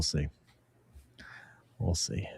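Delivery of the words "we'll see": unvoiced whisper to himself. Real, not acted. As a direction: whisper it under the breath. Repeats, second time whispered.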